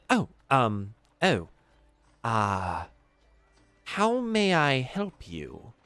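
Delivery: a fussy clerk asking slowly; studious tiefling clerk. Acted. Tiefling clerk greets the half-orc, slow and polite.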